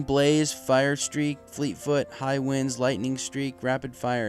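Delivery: plain reading voice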